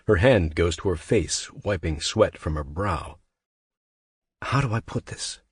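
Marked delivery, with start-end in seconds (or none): 4.4-5.4 put-on Frenchman in a French accent, hopeful and pleading; a French accent, hoping she agrees. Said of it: "he says hopefully".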